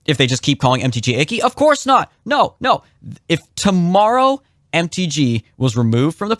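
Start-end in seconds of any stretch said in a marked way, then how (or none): 1.4-2.8 much higher, forceful "no"; emphatic denial. Real, not acted. Host dismissing staffers' hopes, emphatic and higher. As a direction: shut the idea down hard.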